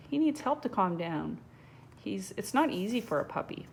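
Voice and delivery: ordinary delivery, nothing marked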